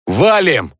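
high, loud barked order; barked shout. A narrator's reading. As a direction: bark the order to flee. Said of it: "гаркнул я".

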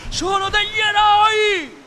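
high, loud, slow praise; thundering proclamation. A narrator's reading. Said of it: "tuonò la voce della tigre".

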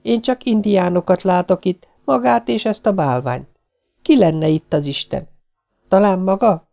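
high, taunting woman's voice; mocking. A narrator's reading. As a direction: needle him with high-pitched scorn. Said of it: "ismételte a lány gúnyosan".

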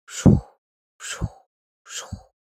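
whispered whooshing wingbeats, fading; breathy swoosh of wings; wing flaps. Acted. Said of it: "Каждый взмах крыла".

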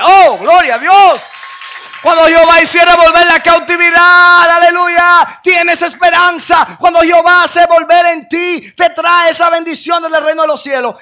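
loud, high shouting proclamation; preaching at full force. Acted. Preacher proclaiming deliverance to the church, loud and high. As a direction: shout the promise to the rafters.

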